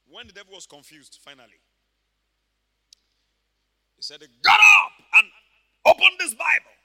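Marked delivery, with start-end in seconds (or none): 4.2-6.7 high, loud, emphatic order; shouted command. Acted. Papa Hagin ordered to open his Bible, shouted command. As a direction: order someone to their feet.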